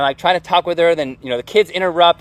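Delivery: usual speaking voice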